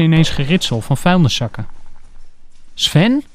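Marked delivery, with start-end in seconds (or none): 2.7-3.2 high rising call of a name. A narrator's reading. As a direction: call his name through the door. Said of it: "roept ze nog eens".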